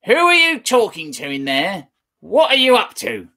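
high, loud, calling from afar; suspicious old woman shouting; screechy grandma. Acted. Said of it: "the screechy voice from the next room".

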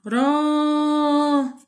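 held madd demo; long vowel. Shown as a demonstration. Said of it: "مد مع ممدود".

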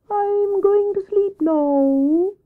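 very high, slow hooting owl; Wise Owl voice. Acted. Owl announces he is retiring, high slow hooting. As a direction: draw it out like a long hoot.

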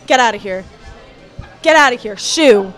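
ordinary talk

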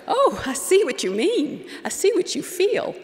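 high-pitched, placating fluster; acting out a taken-aback reply; flustered sputtering. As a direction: backpedal in a high, swooping voice. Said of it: "I sputtered".

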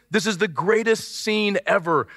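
ordinary talk, nothing marked

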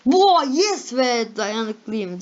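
high, loud, drawn-out celebration; triumphant cheer. Real, not acted. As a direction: cheer for yourself, stretching the words. Own sign appears in the list. (sevinçle, zafer edasıyla)

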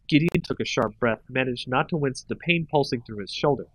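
plain reading voice

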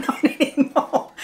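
laughing while saying it. Real, not acted. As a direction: let a giggle run through the words. Laughing through a defiant aside.

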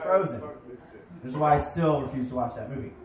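usual speaking voice

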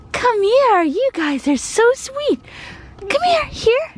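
high, sweet puppy talk. Real, not acted. Mom coaxing a shy puppy closer, high baby talk. Calling dogs.

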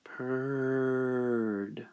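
soft drawn-out purr on the word; cat's purr in the narration. A narrator's reading.

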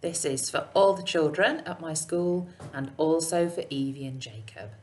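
plain reading voice

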